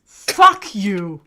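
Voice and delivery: sudden loud outburst; shout. Real, not acted. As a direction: bark it out suddenly. Reacting to bless you sneeze.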